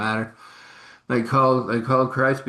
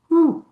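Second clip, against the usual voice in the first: impressed whistle; 8 dB louder than usual. Retold or imitated. Reacting to bank deposit.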